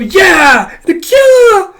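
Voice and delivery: loud, drawn-out squealed shout; over-the-top high holler; high-pitched outburst. Acted. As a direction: shriek it way up high. Acted outburst after invitation.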